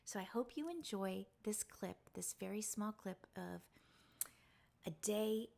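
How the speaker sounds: hushed, intimate confiding voice; soft-spoken. Real, not acted. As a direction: speak softly, close to the mic. Long soft reflective talk to viewers.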